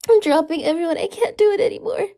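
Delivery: ordinary talk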